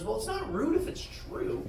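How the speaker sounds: put-on kid's comeback. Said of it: "he shoots back without even thinking".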